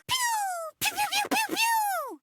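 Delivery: very high rapid-fire "pew" noises; mouthed laser fire. Acted. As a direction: squeal out a volley of laser zaps.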